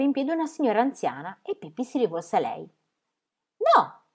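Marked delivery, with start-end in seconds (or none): 3.6-4.0 a sharp, much higher No; firm, high-pitched no; decisive. Acted. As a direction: snap it out with decision. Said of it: "disse con decisione".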